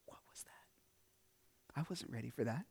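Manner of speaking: breathless whisper, slow and barely voiced; a hushed, dazed whisper after the chaos; stunned whisper. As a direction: let it out as a shaken whisper. Stunned aftermath, rain, car leaving.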